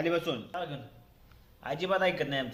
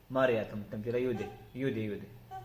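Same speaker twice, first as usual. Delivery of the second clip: hushed, low parental comforting; soothing reassurance; 8 semitones lower, 8 dB quieter than usual. Real, not acted. Parent soothes child with promise.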